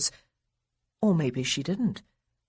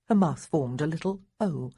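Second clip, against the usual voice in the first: ordinary delivery, nothing marked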